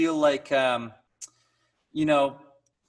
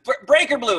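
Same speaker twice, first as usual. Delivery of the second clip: loud, high, stumbling retold shout; urgent call-out; 11 semitones higher, 8 dB louder than usual. Stage colleague warning singer the power failed, urgent call-out. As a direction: imitate a hurried alarm call. Crewman repeating the alarm.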